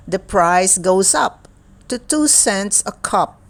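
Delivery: lively, sing-song picture book reading; reading to children. Acted. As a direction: read it brightly to a young child. Picture book read aloud.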